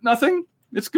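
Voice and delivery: high-pitched, hurried-to-agree retreat; meek backing down. Acted. Cowed viewer answering a challenging star, meekly placating. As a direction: back off from a challenge, voice jumping up high. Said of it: "dares you to say anything".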